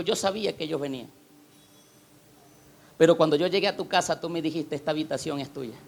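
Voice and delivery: low, softened, unruffled reply; calm answer. Retold or imitated. Jesus calmly answers the man. (respuesta serena en voz baja)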